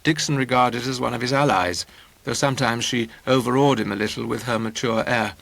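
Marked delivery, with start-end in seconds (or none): none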